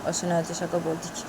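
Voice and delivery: ordinary delivery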